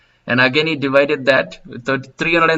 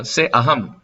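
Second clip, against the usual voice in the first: ordinary talk, nothing marked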